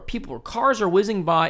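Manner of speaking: ordinary talk, nothing marked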